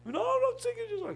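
high, quick protesting whine; arguing with God. Retold or imitated. Pastor refusing God's request to sing, arguing. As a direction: refuse in a high-pitched rush. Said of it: "he argued with God".

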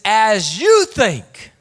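As you think, with you punched loud and slow; preacher leaning on you in As you think; stressed slow quote. Shown as a demonstration. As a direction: hit you hard and draw the line out. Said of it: "It says".

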